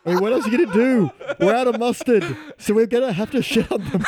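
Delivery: high, laughing through the words; cracking up while talking. Real, not acted. Hysterical laughter over joke.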